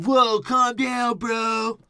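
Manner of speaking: high, slow hammed-up whoa; mock surprised bro. Host voicing the movie hero at a robot, slow high mock surprise. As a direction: stretch out the whoa like a startled bro. Voicing Mark Wahlberg character.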